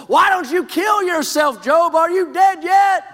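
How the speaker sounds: high shrill-free shouted taunt from across the house; wife yelling. Acted. Wife shouting scornfully at suffering husband Job from far room. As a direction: shout it at him from the far end of the home.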